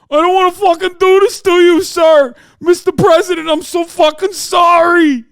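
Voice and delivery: hammed-up wailing New York cop, high and shouted; New York cop accent. Host as crying cop apologizing to Trump, shouted New York accent. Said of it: "wouldn't have that kind of accent".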